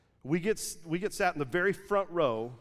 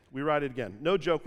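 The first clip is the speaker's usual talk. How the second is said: ordinary delivery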